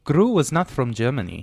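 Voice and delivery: put-on comic German accent. Host disputing the Gru comparison, in a German accent. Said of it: "he sounds just like you".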